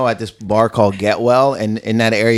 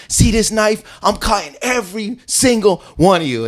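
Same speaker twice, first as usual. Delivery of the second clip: higher-pitched imitated threat; knife threat; 8 semitones higher than usual. Drunk man threatening the group, imitated menace. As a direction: menace the whole group, retold.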